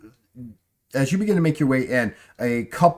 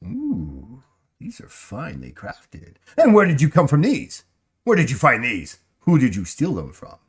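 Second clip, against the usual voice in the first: appraising shopkeeper; 3 semitones higher, 3 dB louder than usual. Acted. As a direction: savour the gems, then pry.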